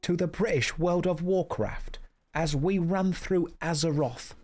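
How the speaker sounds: hammed-up British accent, welcoming viewers; a streamer greeting chat in a mock British accent. A streamer greeting his Twitch chat, hammed-up British accent. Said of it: "in my British accent".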